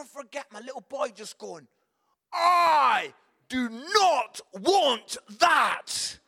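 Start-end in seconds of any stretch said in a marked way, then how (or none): 2.3-6.2 loud, very slow, each word punched; bratty child tantrum. Retold or imitated. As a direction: mimic a wailing child refusing a gift. Little boy rejecting his present, acted.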